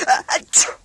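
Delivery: a big voiceless achoo; loud sneeze. A narrator's reading. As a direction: sneeze it out hard. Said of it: "Sneezy gave a very loud sneeze".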